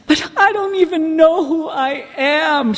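high, loud, drawn-out wail; hammed-up helpless complaint, slow and loud; distraught protest. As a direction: wail it like you're utterly lost. Acting out own protest to sponsor.

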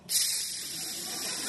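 long soft hiss of a closing door; hissing door closer. Retold or imitated. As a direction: imitate a slow door hissing shut. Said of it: "those things that go".

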